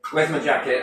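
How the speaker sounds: ordinary talk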